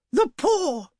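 very high, urgent outburst; wild cry. A narrator's reading. Wife waking husband with a sudden idea, crying wildly. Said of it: "she cried wildly".

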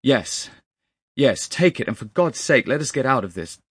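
high, loud, desperate urging; urgent cry. A narrator's reading. Narrator urges the others out of the room, urgently crying out. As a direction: cry out to get away. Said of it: "I cried".

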